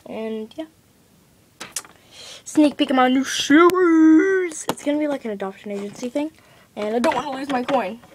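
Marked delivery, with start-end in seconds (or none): 2.4-4.7 slow, higher, louder announcer voice; a trailer-style hype line; promo voice. Pretend.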